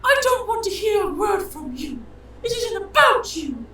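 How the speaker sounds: very high, slow falsetto rebuke; angry wife. Acted. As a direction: shut them down as an indignant wife. Wife cuts off an onlooker.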